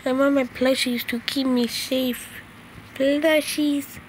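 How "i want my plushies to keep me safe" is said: ordinary talk, nothing marked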